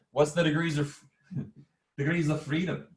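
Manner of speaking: playful, badly faked Irish lilt; bad Irish accent. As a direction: lean into a silly Irish accent. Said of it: "in a bad accent".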